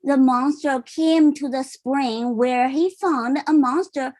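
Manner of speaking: ordinary delivery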